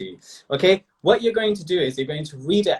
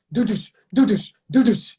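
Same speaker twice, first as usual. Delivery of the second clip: high imitated dramatic crash; soap-opera sting; 5 semitones higher, 3 dB louder than usual. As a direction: voice the suspense hits of a soap. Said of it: "I'll do doo-douche".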